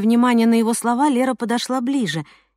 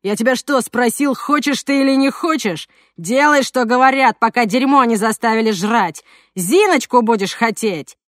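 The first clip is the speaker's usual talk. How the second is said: louder furious thug outburst; angry exclamation; 4 dB louder than usual. Acted. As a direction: blurt it out louder in rage. Thug Zhokh raging.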